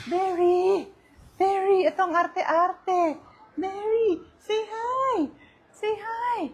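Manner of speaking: high-pitched calling and coaxing. Real, not acted. Owner getting dogs to greet viewers, high pet talk.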